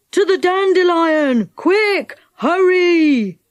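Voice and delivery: high, drawn-out calling to everyone; urgent yell. A narrator's reading. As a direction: yell an urgent order across a field. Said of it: "Saucepan yelled to everyone".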